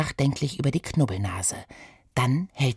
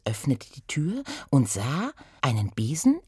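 ordinary delivery

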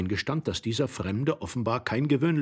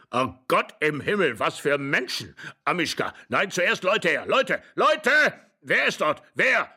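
put-on old man's excited cries, climbing higher; worked-up, louder calls for people; agitated shouting; 6 semitones higher, 3 dB louder than usual. A narrator's reading. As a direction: shout in growing alarm. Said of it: "schrie der Alte ganz heiser vor Aufregung".

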